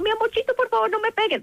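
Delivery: high, sugary begging voice; pleading parent. Shown as a demonstration. Parent begging a child not to hit, hammed-up pleading.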